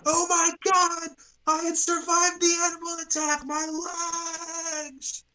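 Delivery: high, loud agonized scream of a wounded man; dying man screaming. Acted. GM voicing a maimed survivor, screaming high in agony. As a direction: wail in pain like your legs are gone.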